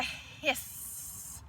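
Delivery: hissed, slow and voiceless; snake voice. Shown as a demonstration. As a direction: hiss it out like a snake. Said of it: "like a snake".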